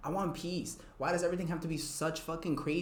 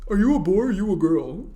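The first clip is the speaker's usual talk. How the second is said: mocking voice; 2 semitones higher, 9 dB louder than usual. Mocking strangers questioning her gender.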